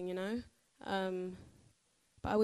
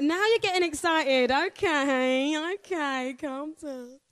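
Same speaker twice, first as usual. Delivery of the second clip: loud, high call over an excited crowd; playful calming down; 6 semitones higher, 11 dB louder, much slower than usual. Real, not acted. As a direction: rein in the crowd, voice raised then easing.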